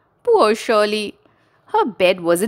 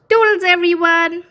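high, bright singsong goodbye; cheery sign-off; 11 semitones higher, 3 dB louder than usual. Real, not acted. Host saying goodbye to young listeners, high cheery.

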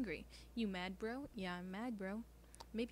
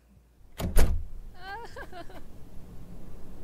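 loud breathy burst of laughter; 10 semitones higher, 16 dB louder than usual. Real, not acted. Player bursts out laughing at the game. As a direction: crack up out loud.

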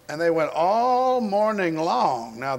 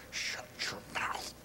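slow, seething whisper; acted whispered grumble; whispered retort; 15 dB quieter than usual. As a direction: whisper it under your breath. Prophets mutter back at Elijah.

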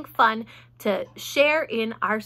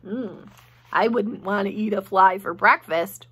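plain reading voice

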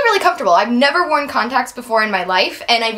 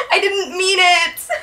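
laughing; 6 semitones higher, slower than usual. Real, not acted. Laughing over getting pulled over.

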